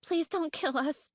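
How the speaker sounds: high, pleading squeak; frightened squeak. A narrator's reading. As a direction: beg in a tiny high terrified voice. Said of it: "Fate Speaker squeaked".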